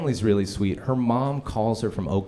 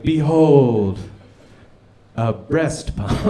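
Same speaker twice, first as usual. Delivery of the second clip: loud proclamation then slow reveal; grand presentation; 3 semitones higher, 6 dB louder, much slower than usual. Retold or imitated. Team leader presenting a gift like Simba, grand. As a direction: announce it like a royal unveiling. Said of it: "like Simba being presented".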